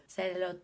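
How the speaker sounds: "Salad" as sel-lo-deu; "Salad" with a full o in the middle, Korean-style; Korean-sounding word. Shown as a demonstration. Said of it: "turned into a Korean sounding word".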